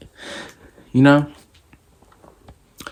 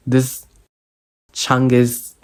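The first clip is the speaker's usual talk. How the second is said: normal talking voice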